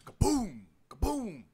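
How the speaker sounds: big loud echo boom; imitated boom. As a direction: shout the echoing kaboom. Kicked trash can echoing boom.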